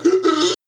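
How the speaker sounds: high winded gasp after a punch; sharp gasp. Retold or imitated. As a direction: gasp as if struck in the chest. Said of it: "four knuckles straight into your sternum going".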